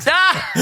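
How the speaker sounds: high-pitched "Ah!"; sudden high exclamation; high outburst. Real, not acted. Host is teased about his slow explanation, high outburst.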